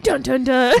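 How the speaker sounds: sung dun dun dun sting; dramatic jingle. Pretend. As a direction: hum a dramatic fanfare. Dramatic jingle before review.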